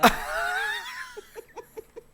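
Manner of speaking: shrieking laugh, falling; high burst of laughter. Real, not acted. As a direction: cackle at the absurdity. Reacting to absurd story.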